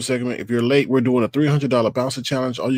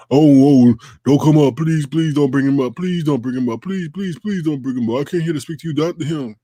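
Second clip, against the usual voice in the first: pleading mockery, a bit louder at the start; mock scared begging; 3 semitones higher, 4 dB louder than usual. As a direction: imitate a cowering man pleading. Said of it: "you scared".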